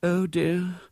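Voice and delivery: weary, drawn-out dismay; groaning dismay. A narrator's reading. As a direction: groan with sinking realisation. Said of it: "groaned Missus Brown".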